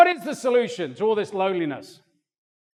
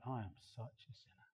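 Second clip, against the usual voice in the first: contrite apology; 11 semitones lower, 17 dB quieter than usual. Retold or imitated. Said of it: "I'm like".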